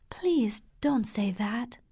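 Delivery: soft whispered plea; hushed, tender whisper; gentle whisper. Acted. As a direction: breathe it gently, begging him to stop. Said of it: "Catherine answered gently".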